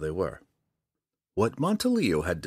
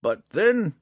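put-on high, louder opening as the old ranger; slow, deliberate; 13 semitones higher, 7 dB louder than usual. A narrator's reading. Old ranger challenging the drow, slow and deliberate. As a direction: begin deliberately, pitched high. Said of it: "Montaleo said slowly".

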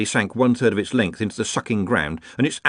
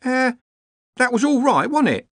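high, hesitant servant voice; falter, then ask for reassurance up high; uncertain cook; 4 dB louder than usual. A narrator's reading. The cook asks officials about the hake, uncertain. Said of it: "Sudden uncertainty seized her features".

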